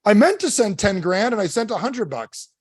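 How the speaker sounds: high-pitched mock excuse; put-on high protest; mock objector. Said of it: "you're gonna be like".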